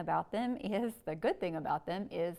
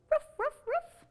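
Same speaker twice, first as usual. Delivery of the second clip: much higher, louder yappy ruffs; high louder puppy barks; little dog bark; 6 dB louder than usual. Acted. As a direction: yap like a tiny dog. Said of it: "little dogs go".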